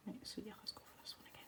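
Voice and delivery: quick whisper to herself while shading. Real, not acted. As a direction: breathe the words out under her breath, fast. Muttering to herself while drawing.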